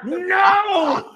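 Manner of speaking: high yelled no; playful cry of defeat; mock despairing shout. Real, not acted. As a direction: wail it out in fake anguish.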